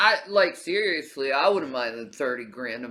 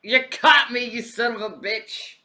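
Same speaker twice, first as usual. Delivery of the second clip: put-on Southern accent; 8 semitones higher, 9 dB louder than usual. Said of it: "I keep putting a southern accent on him".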